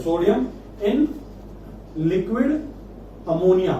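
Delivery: one word at a time, long pauses; slow dictation. Real, not acted. Teacher names the reagent for students, slow word-by-word dictation. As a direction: dictate word by word, leaving writing gaps.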